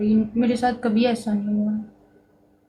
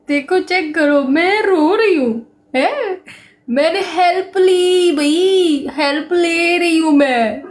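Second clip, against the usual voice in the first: higher and louder, pleading; retold desperate plea; 7 semitones higher, 6 dB louder than usual. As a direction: replay her own cry for help at full pitch.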